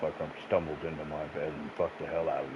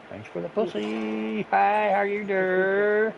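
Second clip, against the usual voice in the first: high, very slow, drawn-out sleazy greeting; mock smooth flirt; 13 semitones higher, 4 dB louder, much slower than usual. Man greeting the neighbor who stumbled in again, slow hammed-up flirt. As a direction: stretch it out like a cheesy charmer.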